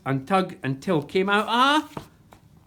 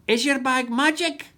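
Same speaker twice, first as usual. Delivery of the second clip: higher, squawky parrot repeat; a parrot repeating Jack; parrot echo; 9 semitones higher, 3 dB louder than usual. Acted. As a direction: mimic the words like a parrot. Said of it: "echoed MacBlether".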